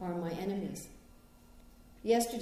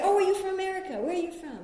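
surprised; 9 semitones higher, 3 dB louder, faster than usual. Retold or imitated. Stranger at airport hears American.

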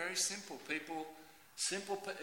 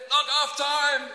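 loud, high countdown bursting into a shout; mock excitement; 10 semitones higher, 13 dB louder, slower than usual. Worker watching the clock reach knock off, mock excitement. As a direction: build to a jubilant yell.

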